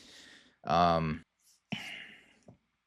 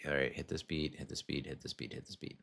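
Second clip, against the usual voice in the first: mock actress ticking off beats, fading to a whisper; mechanical beat-hitting; 3 semitones lower, 11 dB quieter than usual. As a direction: recite it like a checklist, each repeat quieter until it dies away. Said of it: "the wife was just hitting".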